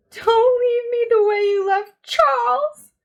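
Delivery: terrified high loud shout. A narrator's reading. Meg shouts in terror at her father not to leave her. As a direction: scream the plea at her father. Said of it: "Meg shouted at her father".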